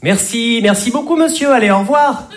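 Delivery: high, rising, exasperated thanks; fed-up politeness. Shown as a demonstration. Comedian mimicking a fed-up dismissal, high and rising. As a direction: brush them off with sugary thanks. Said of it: "quand on en a vraiment marre".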